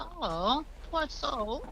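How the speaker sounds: high, soft cooing sympathy; showing empathy. Retold or imitated. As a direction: coo it with retold pity. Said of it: "I'm showing some empathy towards him".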